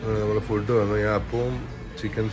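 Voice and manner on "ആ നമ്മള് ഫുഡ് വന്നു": ordinary talk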